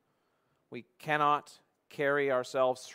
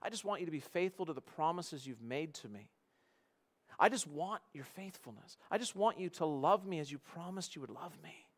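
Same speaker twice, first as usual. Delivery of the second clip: softly entreating husband; quiet, sincere plea; 4 semitones higher, 5 dB quieter than usual. Acted. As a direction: answer the outburst with a hushed, earnest appeal. Husband's hurt, gentle answer acted out.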